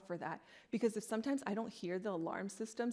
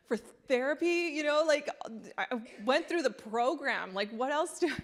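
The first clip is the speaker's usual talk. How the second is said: exasperated outburst; 5 semitones higher, 8 dB louder than usual. Real, not acted. Exasperated after costly therapy.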